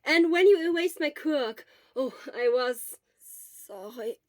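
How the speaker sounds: hoarse sick croak. Acted. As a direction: push it out through a sore throat. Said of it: "Shota croaked".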